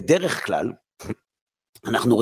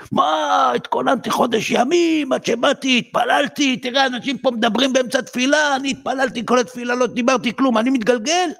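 indignant complaint; 9 semitones higher than usual. Acted. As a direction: protest like a wronged worshipper who prayed all month. Man complains after breaking leg.

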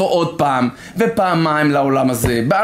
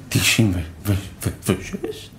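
put-on stunned child; 9 semitones lower, 5 dB quieter, much slower than usual.

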